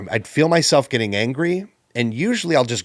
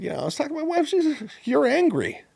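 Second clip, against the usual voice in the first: high mock retelling. Retold telling workers, high voice.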